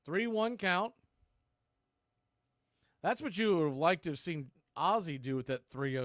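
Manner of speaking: ordinary delivery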